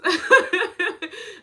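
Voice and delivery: high loud burst of laughter. Real, not acted. Big laugh.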